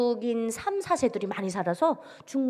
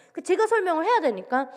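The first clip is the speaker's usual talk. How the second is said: usual speaking voice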